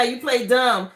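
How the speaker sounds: ordinary talk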